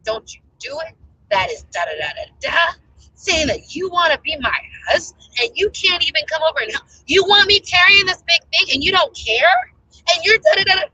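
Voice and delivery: mock furious shouting, pitch climbing; yelling at the boyfriend who won't help, heated; retold angry rant.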